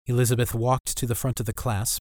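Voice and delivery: ordinary delivery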